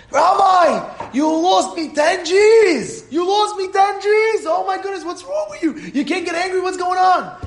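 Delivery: high-pitched, drawn-out exasperated yelling; hammed-up frantic outburst; going crazy. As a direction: lose it completely at the rabbi, voice way up. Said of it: "went crazy on the rabbi".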